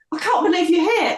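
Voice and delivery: gasping out that dad is really there; retold astonishment. Seeing late father appear.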